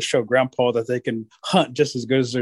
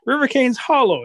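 ordinary delivery

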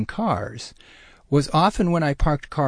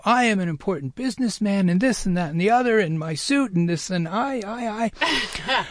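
mock self-important businessman, higher; pompous businessman; 6 semitones higher than usual. Imitating the pompous businessman at the restaurant. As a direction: puff up like a pompous big shot.

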